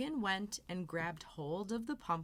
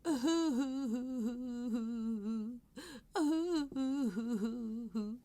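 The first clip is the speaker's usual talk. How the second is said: crying; 5 semitones higher than usual. Acted. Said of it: "and began to cry".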